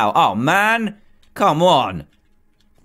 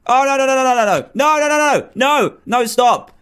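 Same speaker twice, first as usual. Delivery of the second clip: high, louder frantic no no no; panicked; 8 semitones higher, 3 dB louder than usual. Real, not acted. Player fleeing a ghost behind him, panicked high cries. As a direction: cry out as the ghost closes in.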